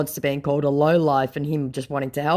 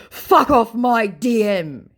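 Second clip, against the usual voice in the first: high, loud, slow outburst; mock scammer anger; 9 semitones higher, 8 dB louder, slower than usual. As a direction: play an angry scammer, hammed-up. Acts out the angry scammer.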